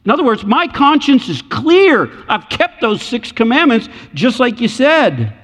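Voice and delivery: put-on a rich young man bragging to Jesus; boastful rich man. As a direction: crow like a man sure he's earned it.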